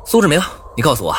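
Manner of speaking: sharp, fast stern demand; stern questioning. A narrator's reading. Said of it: "厉声问".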